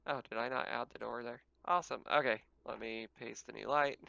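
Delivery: usual speaking voice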